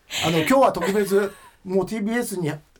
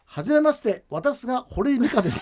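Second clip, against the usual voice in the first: lightly imitating the host, higher and a touch prim; mock self-introduction; 5 semitones higher than usual. 27年前の初対面を再現する、少し高めの真似. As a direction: play the host's first greeting, a bit higher. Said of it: "って言って".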